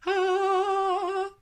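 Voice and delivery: high, sung-out sustained "ah"; showy Leo "ah". Retold or imitated. A Leo saying just look at us, high held showy "ah". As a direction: hold one high, ringing note in mock fanfare. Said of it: "just look at us, like".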